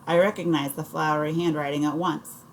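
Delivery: ordinary delivery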